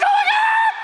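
very high, loud screamed oh my God; terrified scream. Retold or imitated. Jumper screaming in freefall, very high and loud. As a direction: shriek it in freefall. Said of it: "I'm screaming at the top of my lungs".

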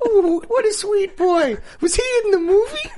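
put-on high, slow, laughing old lady voice; mock sweet old lady. Co-host parodies the old mother's reply, high and laughing. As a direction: coo like a sweet grandma while laughing.

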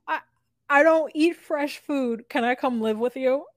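high mocking voice of Statler.

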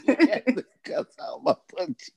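high, laughing retelling. Real, not acted. Laughing with the guests at the butt cheeks line. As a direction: repeat it while cracking up.

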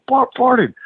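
high, gleeful tattling; giddy announcement. Retold or imitated. Ref runs over to tell Warlord, high and gleeful. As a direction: spread the gossip like a kid. Ref telling everyone, laughing.

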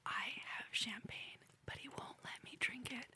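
whisper. Shown as a demonstration. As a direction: whisper it like sharing a secret. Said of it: "do a whine and a whisper".

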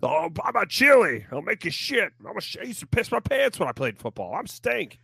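high, fast goofy announcer impression; squeaky mocking broadcaster voice; Mark Schlereth impression. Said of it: "I'm Mark Schlereth".

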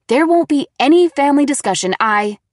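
loud, fast angry outburst; angry shout. A narrator's reading. Daughter furious.

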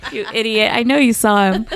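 half-laughing, shove back at the tease; playfully telling off her friend David through laughter; laughing retort. Retold or imitated.

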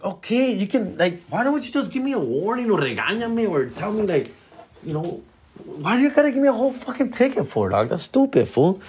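high, whiny complaint; hammed-up whiny plea; mock pleading protest. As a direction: beg him to just warn you. Speaker pleading with cop.

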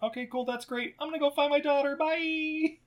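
a lightly voiced dismissive mom; breezy Laura. Recapping, voicing Laura brushing it off.

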